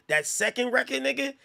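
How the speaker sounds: usual speaking voice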